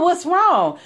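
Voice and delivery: high, baffled question; puzzled. Retold or imitated. Baffled question to the upset mentor. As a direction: plead to know what she did.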